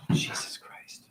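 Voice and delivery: breathy whisper of despair; hushed disbelief; whispered dismay. Real, not acted. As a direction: whisper it, appalled. After a sigh, emotional.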